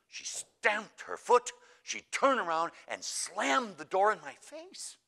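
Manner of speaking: high, loud, drawn-out emphasis; indignant, slow-building complaint; aggrieved retelling. Retold or imitated. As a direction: act out each offence slowly and forcefully. Upset nun recounts the slight.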